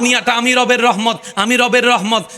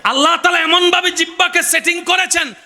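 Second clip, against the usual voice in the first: full-force preaching; 9 semitones higher than usual. Acted. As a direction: proclaim it like a fiery preacher. (উচ্চস্বরে জোরালো ওয়াজ)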